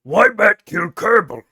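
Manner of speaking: slow, broken orc grunt; lightly voiced dumb orc asking a question; World of Warcraft orc. Streamer mocking chat as a slow orc. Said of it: "sound like fucking World of Warcraft orcs".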